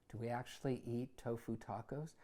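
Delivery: usual speaking voice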